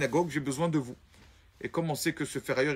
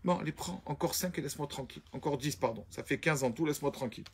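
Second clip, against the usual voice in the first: much softer, tired brush-off; dismissive; 2 semitones lower, 7 dB quieter than usual. Acted. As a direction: get rid of him with a little more money. Rich man brushing off again.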